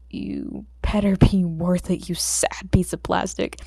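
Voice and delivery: slow breathy whisper at the drive; whispered grumble. Acted. Izuku whispers slowly at the flash drive. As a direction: hiss it at the plastic, slowly.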